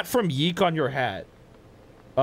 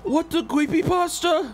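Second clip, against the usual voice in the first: startled. Real, not acted. Creepy area appears.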